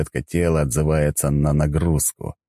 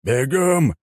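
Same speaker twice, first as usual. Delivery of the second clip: barked command; 8 semitones higher than usual. Acted. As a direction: bark it out like an instructor. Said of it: "рявкнул Геродарец". (рявкающий приказ)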